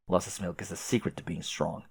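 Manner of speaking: usual speaking voice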